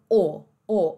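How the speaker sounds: British o, an open "aw" with no R; dropped R. Shown as a demonstration. Teacher contrasting or, British dropped R. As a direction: leave o open, swallowing the R. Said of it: "ao invés de or".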